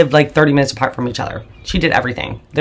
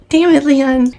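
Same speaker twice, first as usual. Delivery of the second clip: much higher, exasperated Damn it, Leon; exasperated curse; 11 semitones higher, slower than usual. Real, not acted. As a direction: throw the name up high in disbelief. Cursing stepfather twice.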